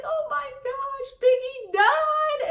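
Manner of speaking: high, slow wail over a dead rapper; hammed-up sobbing cry, voice way up high; mock wailing. Retelling crying at a Netflix show, high wailing. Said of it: "I was like".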